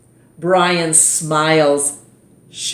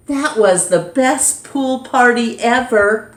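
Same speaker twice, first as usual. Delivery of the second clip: put-on excited kid; 7 semitones higher, 4 dB louder than usual. Kids praise the party.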